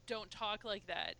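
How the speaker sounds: ordinary talk, nothing marked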